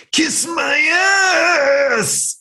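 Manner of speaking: very high, drawn-out screamed curse; dying villain's shrieked defiance; defiant yell. Retold or imitated. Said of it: "dying villain's defiant yell".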